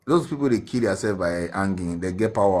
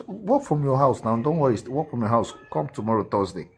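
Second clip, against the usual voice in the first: louder, higher retold instruction; team lead; 5 semitones higher, 5 dB louder than usual. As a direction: imitate a boss insisting over the phone. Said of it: "She just tell me".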